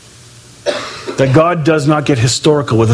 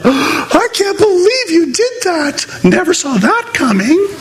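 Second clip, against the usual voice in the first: much higher, slow, hammed-up disbelief; high theatrical dismay; mock shock; 13 semitones higher than usual. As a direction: play a shocked parent, over the top. Mock God surprised.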